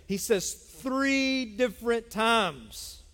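"three" stretched slow and heavy; stress on three. Acted. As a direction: lean hard on "three", slowly. Preacher stressing repeated phrase.

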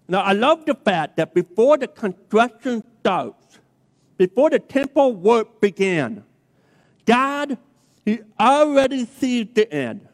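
slow, weighty preacher's delivery with long pauses; emphatic preaching. Acted. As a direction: preach it to the congregation, drawing out key words. Preaching resumes after the quote.

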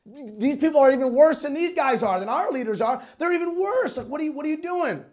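mock-indignant, increasingly agitated complaint; upset protest. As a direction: imitate an upset man challenging God. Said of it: "kinda gets even more upset and says".

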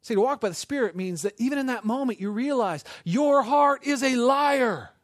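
high voice, slowing to a loud finish; impassioned preaching. Real, not acted. Preacher warning that the heart lies, forceful.

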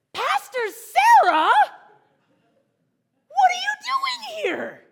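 high, drawn-out shriek of delighted surprise; huge high-pitched happy yell; happy, excited shout. Retold or imitated. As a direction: imitate a thrilled kid shouting a greeting. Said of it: "Like, happy".

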